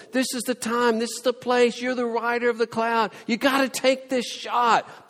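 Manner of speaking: high, loud egging-on of a god; mock pep talk. Preacher egging Baal on, mock pep talk. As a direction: cheer Baal on like a coach.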